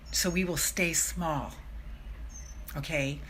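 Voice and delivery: unvoiced, hushed warning; whisper. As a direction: whisper it like a secret. Whispered warning about fear.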